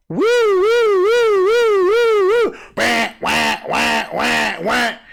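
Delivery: high wailing siren imitation, fading to wah; mock alarm siren sliding down; car alarm wail.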